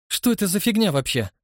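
irritated demanding question. A narrator's reading. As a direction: snap it out, annoyed. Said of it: "потребовал он ответа". (раздражённое требование)

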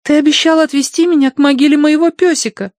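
put-on high, loud child voice; little girl voice. A narrator's reading. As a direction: speak like a demanding little girl.

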